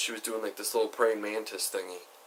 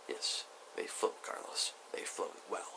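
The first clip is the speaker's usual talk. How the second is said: very soft, slow, voiceless delivery; hushed deadpan reply; 3 semitones lower, 10 dB quieter than usual. Retold or imitated. Teller answering friend's silly kayak question, hushed deadpan. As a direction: answer under your breath, slowly.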